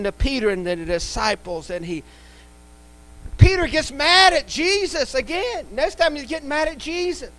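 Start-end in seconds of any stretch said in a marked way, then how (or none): none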